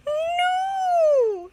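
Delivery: shrill high loud scream. Acted. Headmistress screaming at the bill. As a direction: wail of horror. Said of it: "screamed the headmistress".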